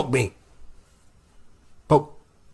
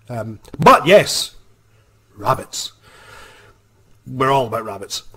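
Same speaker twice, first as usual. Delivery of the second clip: lightly put-on Scottish accent, eager; a hint of a Scottish accent trying to sound qualified; 3 dB louder than usual. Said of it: "that accent, no, won't do".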